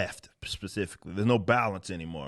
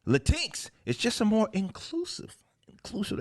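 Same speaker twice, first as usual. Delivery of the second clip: high-pitched mock reading; mocking quote; 11 semitones higher than usual. Host reading comic dialogue to viewers, mocking high voice. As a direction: read it high, poking fun at the term. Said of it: "and as they say".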